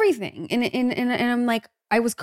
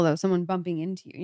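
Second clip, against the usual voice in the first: normal talking voice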